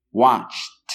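"Watched" as one syllable ending in a crisp t sound; correct English pronunciation. Shown as a demonstration. Teacher modeling "Watched" for Spanish speakers with a clear final t. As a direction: say "Watched" with the tongue released on a final t, no extra vowel. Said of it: "Lo voy a volver a repetir".

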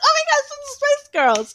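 high, loud squeal of delight; high-pitched fangirl scream; excited scream. Retold or imitated. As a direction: shriek it like a starstruck fan. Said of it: "and I screamed".

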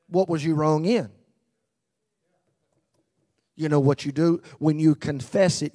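loud, driving sermon delivery; preaching at full force. Acted. Pastor urging the congregation to confess, loud preaching. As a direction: press the point home to the pews.

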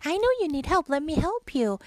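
high kind little fish; Boyfish voice. Acted. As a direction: reassure the starfish. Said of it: "I'll do the Boyfish".

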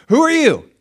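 high, loud scornful taunt; mocking devil. Devil scoffing at the believer, high and loud. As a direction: throw the challenge back scornfully.

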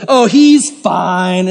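slow, stretched mock owner; dismissive dog owner. Dog owner excusing a jumping dog, slow mocking drawl. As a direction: drag it out as an oblivious owner. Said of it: "the owner's always like".

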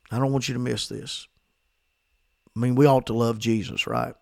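ordinary talk, nothing marked

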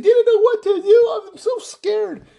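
high, whiny mock sobbing voice; mocking crying officer. Mocking weepy police officers to his listeners. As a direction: sneer at them with a fake teary whimper. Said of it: "crying with tears coming down their eyes and saying".

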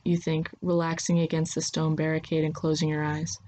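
ordinary delivery, nothing marked

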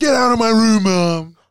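loud, high bratty shout; mock teen yell. Teen kid yelling at mom to leave the room, loud mock tantrum.

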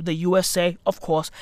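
ordinary delivery

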